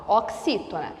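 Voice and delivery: normal talking voice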